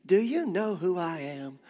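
slow, haughty, offended voice; drawn-out mock outrage, chest puffed out; mock indignation. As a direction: act like someone deeply insulted. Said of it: "And we just puff our chests".

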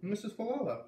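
put-on kid's voice, high and lively; boy's voice. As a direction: answer like a busy young boy. Boy names the old lady.

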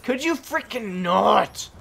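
loud drawn-out complaint; annoyed shout. Real, not acted. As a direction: stretch it out in exasperation.

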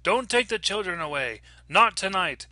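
high, urgent cry, moderately loud; screamed warning. A narrator's reading. Stranger to the mother at the door, screamed warning. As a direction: cry out a desperate warning, short of a full scream. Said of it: "she screamed out".

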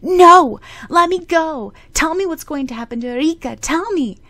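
put-on loud, high, frantic boy's screams; desperate scream. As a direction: fight the nurse off, begging to know. Boy held back by nurse.

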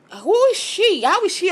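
high, incredulous who is she; outraged disbelief. Real, not acted. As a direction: throw up your hands at the nerve of it. Outraged at Susan's remark.

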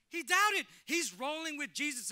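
big high shout on doubted; full-force preaching shout; preached shout. Real, not acted. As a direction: hammer it home to the pews. Preacher hammering the point.

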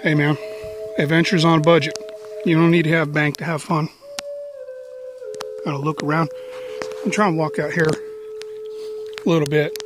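normal talking voice

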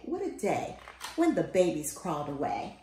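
ordinary delivery

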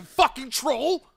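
normal talking voice

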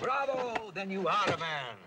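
high, ringing exclamation of praise; hearty applause. Acted. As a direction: cheer him on with gusto. Doctor applauds reverend's supposed courage.

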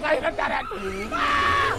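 screams as it catches him; real cornered shriek; panicked scream. Real, not acted. Caught by the monster.

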